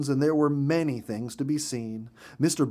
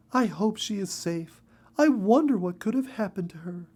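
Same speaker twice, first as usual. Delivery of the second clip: higher, fearful, half-whispered; worried mother bird; 8 semitones higher than usual. A narrator's reading. Mother bird fearing for lost Betty, worried and hushed. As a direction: fret under your breath, high.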